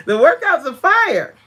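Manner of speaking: high, loud bragging; excited hype. Real, not acted. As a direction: sell it like it's the best. Hyping her own workouts.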